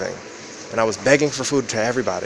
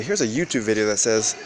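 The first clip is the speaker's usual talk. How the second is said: usual speaking voice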